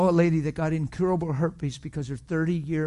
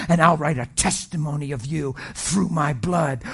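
loud preaching as God's promise; voicing God; 5 dB louder than usual. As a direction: declare it as God speaking.

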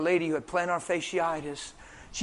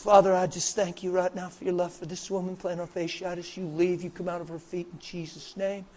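softer, even-pitched murmured prayer; retold healing prayer; 6 dB quieter than usual. Preacher prays for woman's feet in the alley, quiet prayer. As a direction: pray it quietly and without pause, as if over someone. Re-enacts praying over her feet.

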